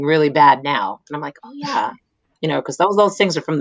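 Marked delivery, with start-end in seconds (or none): 1.4-1.9 high, soft "oh" of acceptance; naive agreement. Retold or imitated. Child self accepting what teachers said, naive agreement. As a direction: imitate a child readily taking it in.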